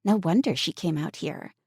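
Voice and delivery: plain reading voice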